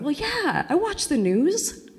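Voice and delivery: high, breezy overconfident voice, laughing; cocky self-mockery. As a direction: act naively sure of yourself. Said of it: "I'm going".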